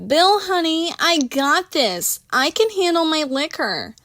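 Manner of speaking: high, slow sing-song tipsy voice; tipsy reassurance. Acted. Tipsy Christina soothing Bill, high and slow. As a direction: pat him on the head with your voice.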